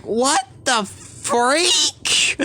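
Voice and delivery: high, drawn-out mock disbelief; hammed-up shock. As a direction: stretch it out high in exaggerated shock. Retold shock at noticing the detail.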